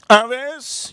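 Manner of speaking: put-on high dad voice; friend's father. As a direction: call it out high, like an odd dad. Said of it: "the father goes".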